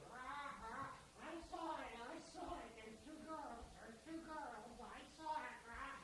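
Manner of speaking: high, soft squawky parrot. Acted. Parrot giving away the sawing trick, soft high squawk. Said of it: "The parrot goes".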